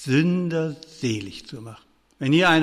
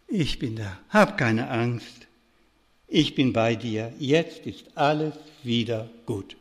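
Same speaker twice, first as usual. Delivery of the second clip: soothing mother; 6 semitones lower, 6 dB quieter than usual. Acted. Mother holds trembling child, consoles.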